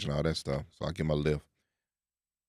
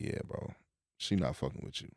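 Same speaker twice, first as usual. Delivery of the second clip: quiet, breathy under-the-breath remark; muttered aside; 5 semitones lower, 10 dB quieter than usual. Retold or imitated. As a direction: say it to yourself so the driver can't hear. Private thought about the driver.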